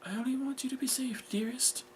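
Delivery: high, soft motherly reassurance; tender mother. Acted. Mother wants daughter safe, tender. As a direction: soothe gently up high.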